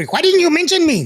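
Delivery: hammed-up mother scolding; imitating mom. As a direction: play his mom whining playfully. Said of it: "you gotta come over".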